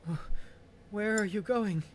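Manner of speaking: high choked distressed question; choking voice. Acted. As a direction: choke it out through tears. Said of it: "hear the choking voice of the woman say".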